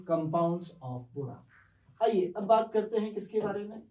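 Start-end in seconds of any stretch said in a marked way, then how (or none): 0.1-1.4 drawn out, very slow; very slow classroom summary; slow closing heading. Shown as a demonstration. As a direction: wrap up the topic title slowly. Teacher closes topic heading.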